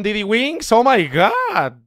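normal talking voice

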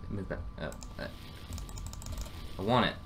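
normal talking voice

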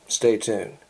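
usual speaking voice

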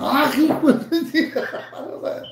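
high, slow curse through laughter; laughing mock curse. Shown as a demonstration. Streamer cursing rival for fun, laughing. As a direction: drag it out while laughing.

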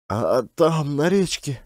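hesitant, halting woman's answer; stammering. Acted. Varvara answering gang boss Klin, stammering. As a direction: stammer nervously, voice lifting. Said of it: "заикалась Варвара".

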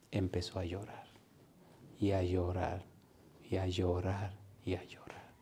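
soft, low, fading repetition; hushed storytelling. Real, not acted. Preacher telling how the man began to weep, hushed. Solemn climax of retold conversion.

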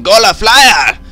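loud, high announcement of a find; hammed-up exclamation. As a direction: belt it out with mock importance. Mock-dramatic reading of found item.

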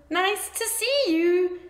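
high friendly hoot; high cheerful hoot. A narrator's reading. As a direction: greet him with a high hooting call. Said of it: "they hooted".

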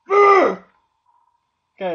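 high, loud burst of exasperation; sudden frustrated yelp; loud exasperated okay. Real, not acted. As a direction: blurt it out fed up. Sudden outburst.

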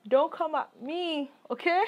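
sassy warning. Real, not acted. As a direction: tease them, don't you dare. Repeated sassier, higher.

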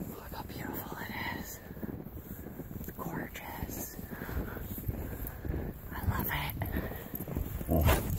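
soft whisper, slow and hushed. Real, not acted. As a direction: whisper so nobody wakes up. Hushed at night in the park.

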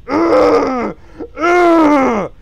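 loud, high drawn-out growl; hammed-up high "ugh"; mock angry roar. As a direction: roar it out like a cartoon grump. Voicing the angry drawn face.

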